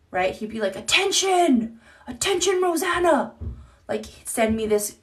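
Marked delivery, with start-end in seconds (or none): 0.8-3.3 mock all-caps ex. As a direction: ham up the ex's shouty emails. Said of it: "it would be all in caps".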